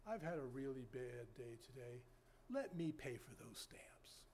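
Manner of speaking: soft, low retelling of a stranger's kindness; hushed, unhurried quoted offer; retold gentle offer. Retold stranger's kind, quiet offer.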